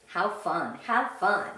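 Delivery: normal talking voice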